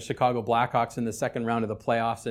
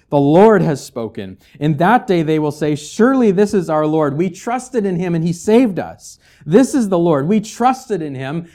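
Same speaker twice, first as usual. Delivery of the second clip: louder, measured Bible reading; scripture reading; 4 semitones higher, 8 dB louder than usual. Acted. Pastor reading Isaiah to the congregation, proclaiming. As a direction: read the verses out like a proclamation. Reads Isaiah passage aloud.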